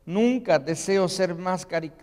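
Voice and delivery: normal talking voice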